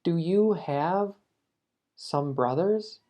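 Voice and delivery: slow, careful read of the mistake; slow wrong example. Shown as a demonstration. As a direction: show the wrong form slowly. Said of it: "Tampoco quieres decir". (ejemplo incorrecto, lento)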